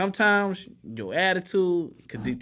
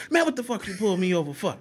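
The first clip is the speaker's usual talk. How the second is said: higher-pitched mock indignation at being stopped; irate driver; 5 semitones higher than usual. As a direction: play a driver kicking off at the officer. Acting out driver confronting the officer.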